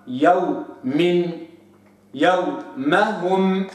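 يوم and يومهم drawn out very slowly with a soft, relaxed sukun waw; soft waw demo. Shown as a demonstration. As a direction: stretch the waw in يومهم gently. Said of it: "هكذا لابد أن نحقق رخوة الواو".

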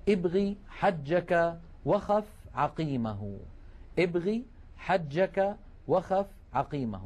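word by word, with gaps; slow dictation. Shown as a demonstration. Teacher giving students the mnemonic to memorize, slow dictation. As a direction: recite it slowly, each word set apart.

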